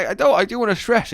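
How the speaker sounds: ordinary delivery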